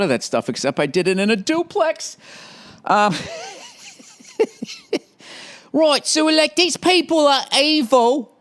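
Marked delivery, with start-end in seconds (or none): 5.7-8.3 high, slow mock English accent; hammed-up English voice pitched way up. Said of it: "hearing that stupid accent".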